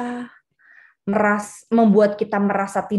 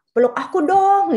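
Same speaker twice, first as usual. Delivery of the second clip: needy plea; 8 semitones higher, 4 dB louder than usual. Retold or imitated. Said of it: "gitu ya".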